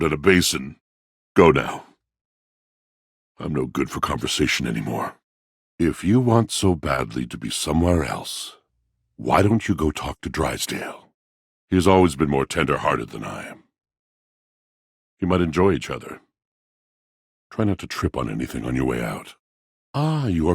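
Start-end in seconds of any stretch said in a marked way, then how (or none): 1.3-1.9 curt dismissal. Acted. Dismissing the traveler. 3.4-19.4 sulky dismissal. Acted.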